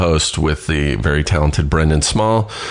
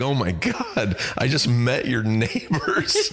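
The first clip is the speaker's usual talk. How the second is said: higher, dragged-out dismay breaking into laughter; mock horror; 6 semitones higher, 6 dB quieter, slower than usual. Retelling the friend seeing himself in the mirror, mock horror. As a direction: imitate a mortified friend, amused. Actor's horrified realization at mirror, retold.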